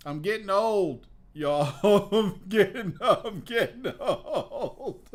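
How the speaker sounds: laughing hard, stretching it out. Real, not acted. Comic cracking up about getting old. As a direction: dissolve into laughter.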